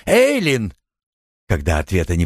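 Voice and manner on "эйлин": high, sharp call of the name; urgent call. A narrator's reading. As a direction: call out to someone behind a door. Calling out at the locked door.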